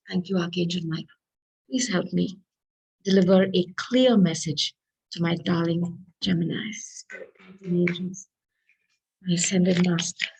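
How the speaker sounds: soft, hushed prayer to the angels; quiet prayer. Acted. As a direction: pray quietly, eyes closed. Prays to the angels.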